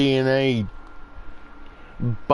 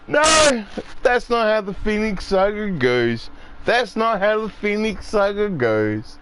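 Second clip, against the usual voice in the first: higher, sharp outburst of disbelief; exasperated fan protest; 8 semitones higher than usual. Retold or imitated. Fan objecting to the cartoon's Phoenix Saga, higher exasperated cry. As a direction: protest like a fan whose favourite story got wrecked. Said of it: "it's like".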